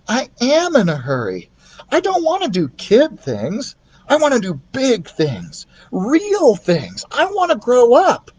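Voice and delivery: put-on rising high, longing boy; boy who wants to grow up; high boy voice, building; yearning boy. Keith voiced.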